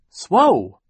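put-on high, loud baby shriek; a sudden piercing baby squeal. Baby shrieking at the leech news. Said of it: "Sunny shrieked".

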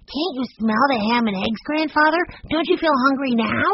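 put-on high, eager child voice; hungry little boy. A narrator's reading. Benny asks grandfather about supper, high and eager. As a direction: coax excitedly in a high pitch.

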